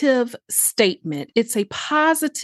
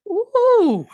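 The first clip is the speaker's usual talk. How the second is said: delighted high ooh; 11 semitones higher, 3 dB louder than usual. Real, not acted. Delighted reaction after affirmation.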